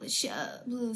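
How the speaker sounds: usual speaking voice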